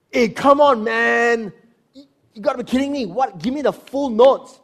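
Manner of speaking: high, loud exasperated yelling; mock angry shout. Pastor mimicking a boss yelling at a staffer for notes, loud mock shout. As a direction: bellow it like a fed-up boss.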